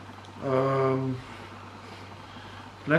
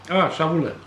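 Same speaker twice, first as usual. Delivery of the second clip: ordinary talk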